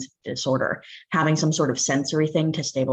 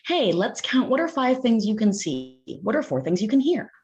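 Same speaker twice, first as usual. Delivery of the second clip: put-on higher and bright, coaxing a child along; encouraging grounding prompt; 7 semitones higher than usual. Shown as a demonstration. Teacher guiding an upset kid through grounding, encouraging. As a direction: gently lead a kid into counting. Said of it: "a breath exercise or the thing that's like".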